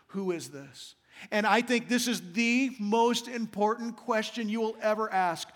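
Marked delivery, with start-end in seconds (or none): 0.1-1.0 low, soft and hushed; hushed wonder. A narrator's reading. Disciples in the still night after the storm, hushed question. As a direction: ask it quietly into the night. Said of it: "in the stillness of the night".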